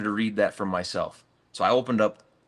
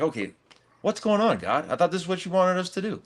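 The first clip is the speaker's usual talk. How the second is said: exasperated plea thrown up high at God; replaying his frustration, pitch jumping up; frustrated; 9 semitones higher than usual. Questioning God after the deal fell through, frustrated. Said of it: "And I'm getting kind of frustrated".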